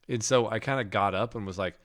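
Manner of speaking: ordinary talk, nothing marked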